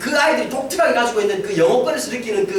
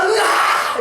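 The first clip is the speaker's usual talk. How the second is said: mocking crowd roar; 8 semitones higher, much slower than usual. Crowd jeering at blinded Samson with a mocking roar. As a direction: roar at the captive like a gloating mob.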